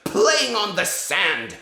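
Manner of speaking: put-on very high, loud, slow screech with a Slavic accent, rolled R's; a dad screeching the witch's lines for his kids; Grand High Witch. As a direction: screech it out like a furious witch queen. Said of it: "here's what it sounds like when you read The Grand High Witch".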